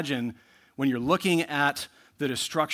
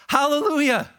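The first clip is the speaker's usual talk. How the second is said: Hallelujah called out much higher and louder; crying out; 13 semitones higher, 9 dB louder than usual. Acted. Preacher reading the multitude's cry to the congregation, loud proclamation. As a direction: proclaim it like a great multitude in heaven. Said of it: "crying out".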